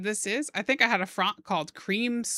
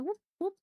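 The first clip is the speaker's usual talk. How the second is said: high, soft rising "whoop, whoop"; soft little whoops jumping up high; chonky boy sound effect; 12 semitones higher, 6 dB quieter than usual. Pretend. Artist voicing a line swooping up, playful whooping sound effect. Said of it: "That's the sound of chonky boys".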